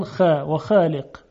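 خالق with a light front a, "xæːleq"; Egyptian-radio thin خ in خالق; thinned kha. Retold or imitated. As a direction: keep the vowel of خالق thin and forward.

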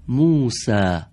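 موسی read out loud and clear, musæ; model reading. Shown as a demonstration. (خواندن نمونهٔ بلند موسی)

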